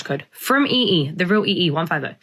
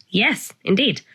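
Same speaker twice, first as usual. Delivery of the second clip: normal talking voice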